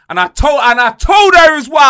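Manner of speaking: very high, loud and excited; nearly shouting with disbelief; worked-up outburst. Real, not acted. Telling his co-host how he answered the schoolgirl, loud and excited. Fuming retelling, voice jumps.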